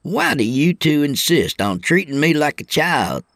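high-pitched put-on youngster voice; exasperated young trainee. Trainee to two older truckers, exasperated. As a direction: protest like the kid of the group.

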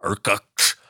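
put-on "Irkutsk" as a drunken retch, ending in a throaty cluck; choked drunken noise. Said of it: "sounds like a noise that I make as I'm stumbling out of the bar".

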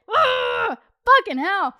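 high, loud fed-up outburst; exasperated groan. Retold or imitated. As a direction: let out an exasperated "ugh" and curse. Acts exasperation at constant interruptions.

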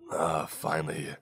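slow, sleepy, just woken up; groggy voice. A narrator's reading. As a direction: drag it out like you just woke. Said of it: "he said with a groggy voice".

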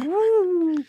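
very high whooping cheer; gleeful woo. Retold or imitated. Unrolling toilet paper at home with wife, high gleeful whoop. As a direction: whoop like a kid playing.

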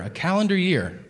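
usual speaking voice

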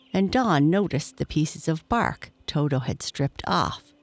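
ordinary delivery

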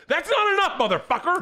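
very high, loud comic outburst; mock outraged shout. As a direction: explode in hammed-up outrage. Acted outburst over faint praise.

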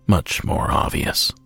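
ordinary talk

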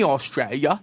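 lightly put-on Aussie accent; Australian accent. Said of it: "I love that accent".

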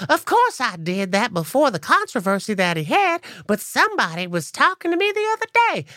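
sweet high country voice, lightly sung out. Retold or imitated. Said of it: "She says".

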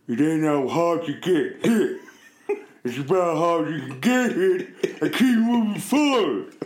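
impression of a movie boxer rallying himself; mumbled boxer's pep talk rising into a laughing finish; Sly Stallone impression. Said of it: "Go on, three".

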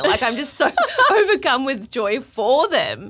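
loud, high gush with a laugh; overjoyed gushing. Real, not acted. Guest overcome with joy for performers, gushing. Gushes about performers she admires.

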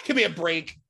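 laughing disbelief. Real, not acted. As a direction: give me a break through a laugh.

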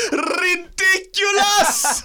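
high slow laughing yell; laughing shout. Pretend. Laughing mock exclamation.

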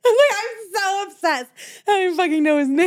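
high, laughing words, fast at the end. Real, not acted. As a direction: laugh through it, embarrassed. Laughing at own confusion.

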